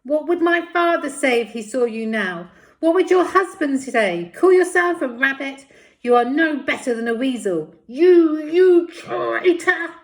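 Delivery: high, loud furious shouting. Acted. Paz shouting down the tunnel at the lady, enraged yell. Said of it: "shouting down the tunnel at her".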